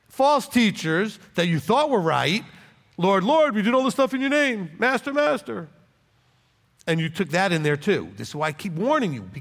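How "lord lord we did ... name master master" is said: higher-pitched mock pleading; pleading followers. False teachers pleading with their Lord, mock earnest appeal. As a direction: imitate followers protesting their good works.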